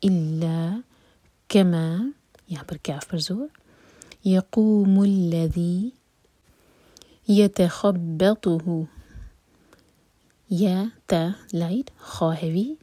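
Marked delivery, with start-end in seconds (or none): 4.2-8.7 slow tajweed recitation, heavy تا in یتخبطہ; Quranic recitation. Shown as a demonstration. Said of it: "اور اس کے بعد تا ہیوی".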